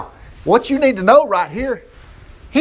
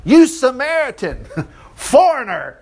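higher-pitched name-calling, breaking into a laugh; mock insult; 7 semitones higher than usual. Pharisees calling Jesus names, mock insulting. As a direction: hurl hammed-up insults with a laugh.

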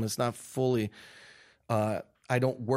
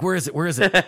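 loud, urgent retold yell; 4 semitones higher, 6 dB louder, faster than usual. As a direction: demand it loudly, panicked. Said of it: "I'm yelling at the Nigerian driver".

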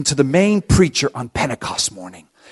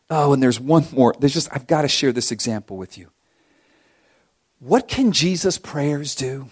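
ordinary delivery

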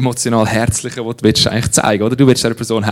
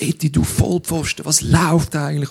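mock telling-off; 6 semitones higher than usual. Preacher pretends to dress down Eti, mock telling-off. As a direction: play at chewing someone out. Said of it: "wenn ich ihn würde da zusammenstauchen".

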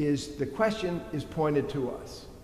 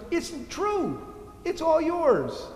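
tender father; 12 semitones higher, slower than usual. Acted. Father tenderly answering elder son.

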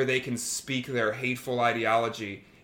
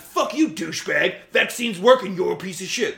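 a touch louder, higher snarling insult; mock angry insult; 4 semitones higher, 4 dB louder than usual. Mocking what she won't do: a mild mock rant at a troll. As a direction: lightly act a hothead cursing out a troll. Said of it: "I'm not coming in and being like".

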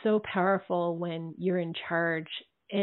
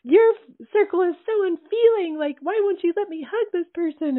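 high, indignant mock complaint; imitating mad complainers; 11 semitones higher, 5 dB louder than usual. Women complaining they can't hug someone, indignant imitation. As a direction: pitch it way up, protesting as the offended women. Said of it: "women who get really mad at me".

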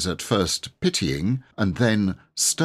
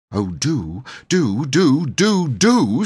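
pleading that builds from plain to high and loud; begging; 9 semitones higher, 7 dB louder, slower than usual. Acted. Boy begs the fairy for a wish, pleading. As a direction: beg harder with every do.

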